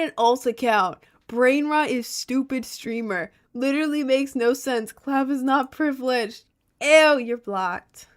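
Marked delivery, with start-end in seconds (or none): 1.3-3.3 high, slow mocking chat voice; mock the insult in a silly voice. Shown as a demonstration. 6.8-8.1 disgusted dismissal. Real, not acted. Blocking a troll.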